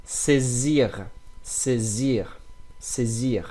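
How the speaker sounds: Saisir with middle s voiced as z. Shown as a demonstration. Teacher demonstrating saisir to learners. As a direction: buzz the s in saisir like a z. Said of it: "pronounce the S with like Z".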